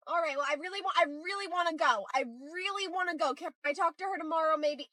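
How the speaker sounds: soft, impatient begging; pleading to leave. Acted. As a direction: plead softly, wanting to get away. Parent pleading to leave, voice lowered.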